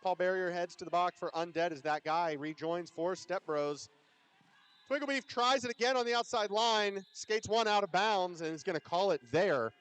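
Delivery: loud, lifted roller derby call; live play-by-play. Real, not acted. Announcer calls a skater trying the outside line, excited play-by-play. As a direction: call the action with rising energy.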